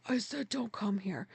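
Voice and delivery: low-volume, stern retold scolding; glaring. Glaring at the friend who walks in anyway, stern and quiet. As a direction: re-enact a quiet, controlled reproach. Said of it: "I glare at her".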